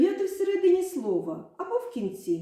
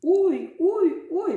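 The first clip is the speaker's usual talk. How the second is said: high, louder oy oy oy; cry of pain; 7 semitones higher, 4 dB louder, much slower than usual. Shown as a demonstration. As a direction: wince as if a finger hurts. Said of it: "А коли поранили пальчик, як кричать?".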